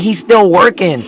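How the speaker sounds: mimicked ridicule; mocking jeer. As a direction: jeer at the one left behind. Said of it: "they laugh at you".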